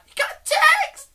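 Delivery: extremely high, loud squeal; mock screaming. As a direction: imitate an overexcited contestant's shriek. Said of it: "That's what it sounds like".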